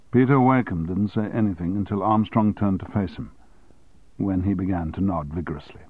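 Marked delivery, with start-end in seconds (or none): none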